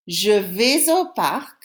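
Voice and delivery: slow French in separate chunks with long gaps; teacher reading in chunks for pupils to repeat; slow, in pieces. Shown as a demonstration. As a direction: read each piece slowly and wait. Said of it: "I'm gonna read it in pieces. You say the piece after me".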